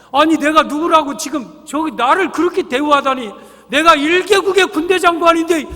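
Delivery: loud, high-pitched indignant outburst; hammed-up outrage, pitched high and loud; acted fury. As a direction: play an insulted general blowing his top. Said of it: "막 화가 머리끝까지 났어요".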